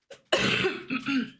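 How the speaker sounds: short real cough; dry cough. Real, not acted. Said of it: "je tousse encore un peu".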